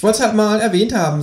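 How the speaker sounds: high creature voice, casual; Känguru. A narrator's reading. Kangaroo explains its sudden confession, high casual voice. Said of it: "sagt das Känguru".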